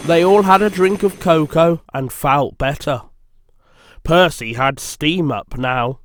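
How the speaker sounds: plain reading voice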